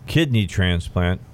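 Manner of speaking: ordinary talk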